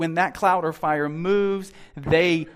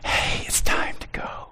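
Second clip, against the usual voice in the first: whispered God's voice. Shown as a demonstration. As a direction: whisper it gently. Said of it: "so that you can't hear this voice".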